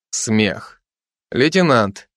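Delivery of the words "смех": ordinary delivery, nothing marked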